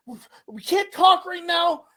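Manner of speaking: high-pitched flustered mom imitation; panicked mom. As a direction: mom rushing her son off the phone. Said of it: "she's like".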